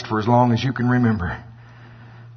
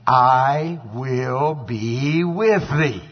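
very slow, drawn-out pronouncement; the Lord's promise; 2 semitones higher, much slower than usual. Acted. The Lord promising Gideon his presence, very slowly. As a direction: stretch each word out with weight. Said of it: "the Lord said unto him".

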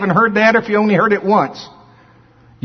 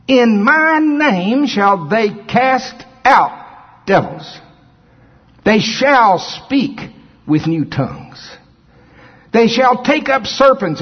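slow, drawn-out scripture read; preaching scripture; 5 semitones higher, much slower than usual. Acted. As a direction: preach each word slowly. Slow emphatic scripture reading.

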